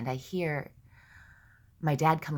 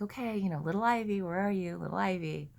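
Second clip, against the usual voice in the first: put-on gentle, higher-pitched call to a child; calling out searchingly; 5 semitones higher, 3 dB louder than usual. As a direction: call out as if looking for a hidden child. Searching for her inner child.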